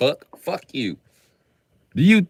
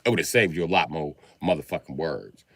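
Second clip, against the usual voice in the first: normal talking voice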